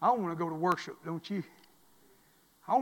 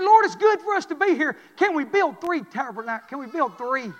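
louder, higher, eager blurting; eager Peter; 8 semitones higher, 11 dB louder than usual. Acted. Peter to the Lord on the mountain, eagerly blurting. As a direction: blurt it out like an overexcited disciple.

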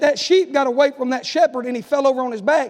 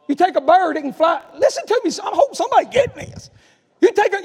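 higher, excited sermon build; rising preaching; 6 semitones higher, 3 dB louder than usual. Acted. Preacher to congregation, higher excited build. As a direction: climb into the preaching. Preacher warming up, pitch climbing.

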